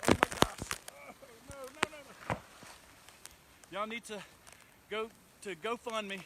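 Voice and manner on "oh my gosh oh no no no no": alarmed gasp. Real, not acted. Wind gust in hurricane.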